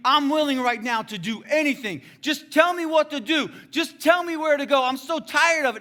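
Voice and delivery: desperate plea climbing high and loud; crying out to God. Retold or imitated. Drunk man in his basement cries out to God, desperate. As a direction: beg for an answer at full voice. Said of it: "I'm just crying out to God".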